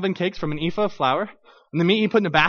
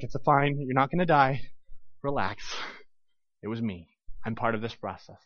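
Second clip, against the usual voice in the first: reassuring; 6 semitones lower, 7 dB quieter than usual. Acted. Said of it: "Okay, relax".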